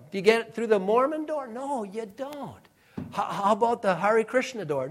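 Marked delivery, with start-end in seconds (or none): 1.5-2.5 quiet firm no. Acted. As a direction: shut that door gently but surely. Repeated refusal, slower and softer.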